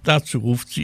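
ordinary delivery

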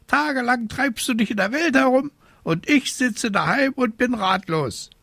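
high, fretful bird scolding; squawking raven. A narrator's reading. Raven left at home worrying, squawking complaint. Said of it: "krakeelte er".

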